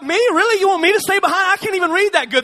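put-on boy shocked at being asked to tutor; surprised kid. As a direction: play the disbelieving kid. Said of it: "And I'd be like".

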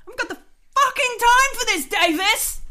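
high, loud outburst at a crewmate; exasperated shout. Acted. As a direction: yell it in angry disbelief. Angry boss snaps at pilot.

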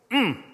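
short high hum with a falling tune; imitated appreciative "mm"; approving "mm". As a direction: hum approval, as if relishing something good. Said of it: "gives it that verbal affirmation".

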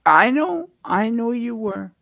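high, loud I know; emphatic reassurance. Real, not acted. Host soothing co-host, high and loud. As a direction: rush to reassure, pitch jumping up. Co-host placating hurt partner.

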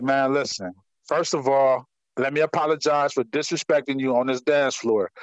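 low, measured, conciliatory; retold soft-pedaled apology; calm apology. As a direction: bring it down low to smooth things over. Retold calm apology to offended woman.

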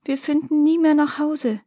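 put-on high-pitched lament of a little girl; tearful girl. A narrator's reading. Little girl lost in the woods with her brother, tearful. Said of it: "begann zu weinen und zu schluchzen".

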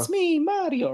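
high, hammed-up Italian accent, imitating the ad; mock Mario Italian accent. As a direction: pitch it way up in a cartoon Italian plumber accent. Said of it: "thick-ass accents".

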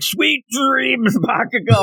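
very high, over-the-top taunt; hammed-up triumphant call. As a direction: call it out in mock heroic excitement. Mimics character getting too into it.

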